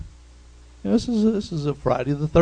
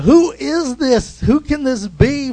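loud, high-pitched astonishment; marveling; 10 semitones higher, 5 dB louder, slower than usual. Acted. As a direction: marvel in amazement, pitch leaping up. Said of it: "and they marveled".

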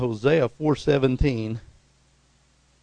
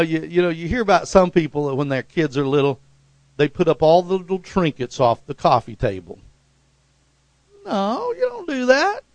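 ordinary talk

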